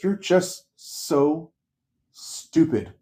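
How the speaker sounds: high, drawn-out exasperation, a pause before the last word; retold exasperation. Frustrated trainee telling off her boss, slow exasperated disbelief. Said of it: "she was so frustrated".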